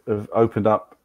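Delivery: ordinary talk, nothing marked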